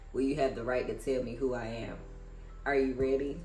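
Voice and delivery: ordinary talk, nothing marked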